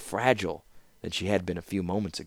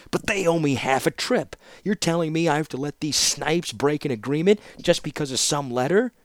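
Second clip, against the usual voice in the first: indignant protest; 8 semitones higher, 3 dB louder than usual. Acted. Wagon master protests the broken deal.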